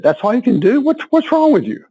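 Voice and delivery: high teasing disbelief; lightly voiced trainer scolding; mock trainer taunt. Trainer teasing him after fifty push-ups: high mock disbelief.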